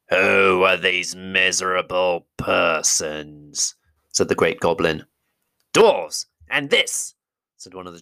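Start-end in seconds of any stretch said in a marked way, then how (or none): 0.1-3.7 slow, menacing goblin king; great goblin voice. Acted. 5.7-7.1 high, loud goblin guard; goblin driver voice. Acted.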